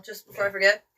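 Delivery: usual speaking voice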